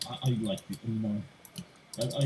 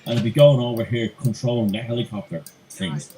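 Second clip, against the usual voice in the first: usual speaking voice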